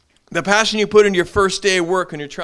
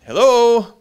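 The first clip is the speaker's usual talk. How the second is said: mock loud hello; 7 semitones higher, 9 dB louder than usual. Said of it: "It's like".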